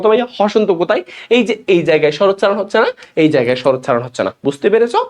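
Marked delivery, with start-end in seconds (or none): none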